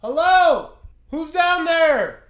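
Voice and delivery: high, drawn-out shout; calling out loudly. Retold or imitated. Man calling to whoever is under the floor, loud shout. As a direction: holler down into the dark.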